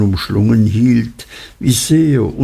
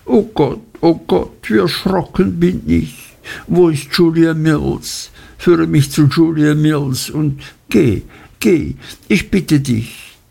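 put-on higher-pitched, alarmed young woman pleading; frightened exclaiming; 6 semitones higher than usual. A narrator's reading. Dora begs her suitor to fetch her friend, frightened. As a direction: cry out in mild panic, voice lifted high. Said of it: "konnte die arme kleine Dora bloß ausrufen".

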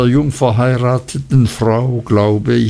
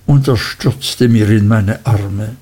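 ordinary delivery

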